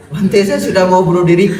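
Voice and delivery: loud high plea, laughing at the edges; mock wail of despair; desperate outcry. Umat berseru putus asa, diulang. (seruan putus asa setengah tertawa)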